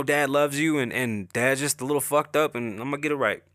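ordinary talk, nothing marked

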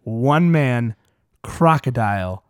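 slow, deliberate, word by word; over enunciating. Shown as a demonstration. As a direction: savour each word slowly. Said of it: "I'm gonna say that one more time".

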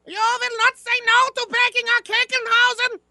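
high, put-on dictator voice; mock tyrant voice. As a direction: bark orders like a tyrant. Mock authoritarian command.

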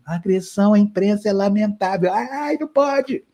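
high, thin, meek little voice; thin reedy voice. Retold or imitated. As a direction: shrink into a timid squeak. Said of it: "E aí ele fala fininho".

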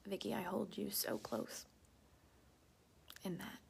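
soft, close reassuring whisper; tender whisper. Real, not acted. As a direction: hold her gently with words. Comforting a viewer who shared.

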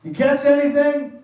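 high, carrying call from the shore; a lightly voiced Jesus calling from the shore; calling out across the water. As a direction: sing it out to the boat like a friendly shout. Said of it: "he calls out to them".

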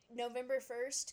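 ordinary talk

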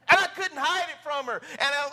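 high, loud through laughter; laughing. Real, not acted. Preacher laughing at his own childhood story. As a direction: laugh while telling it.

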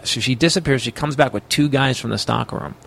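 ordinary delivery

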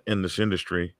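ordinary delivery

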